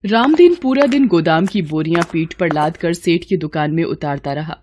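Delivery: ordinary delivery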